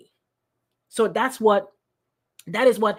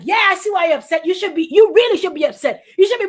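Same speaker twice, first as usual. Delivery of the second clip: fast, higher, emphatic agreement; louder, worked-up validation; egging on; 5 semitones higher, 6 dB louder, faster than usual. Retold or imitated. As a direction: imitate someone hyping up an upset friend. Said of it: "But if Apostle be like".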